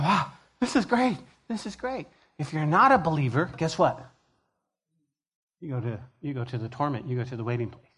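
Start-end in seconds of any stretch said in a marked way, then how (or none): 0.0-2.1 higher awed voice, fading to a hush; amazed. Pretend. Believer in heaven marveling to himself, awed and higher. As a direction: marvel at heaven, then breathe it. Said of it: "You're like".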